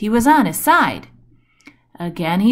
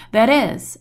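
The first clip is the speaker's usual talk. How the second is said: plain reading voice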